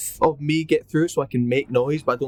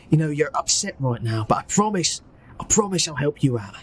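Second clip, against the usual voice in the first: reassuring Cockney, pitched up; Cockney accent; 4 semitones higher than usual. Acted. Petey promising to help an upset prisoner, reassuring Cockney. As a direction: comfort them in a put-on Cockney. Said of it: "do his best Cockney accent".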